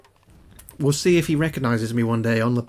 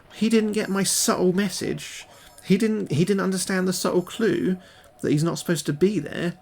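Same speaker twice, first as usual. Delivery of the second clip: mock indignation; 6 semitones higher than usual. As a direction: play up being affronted at an ignored hint. Mock indignation at ignored hint.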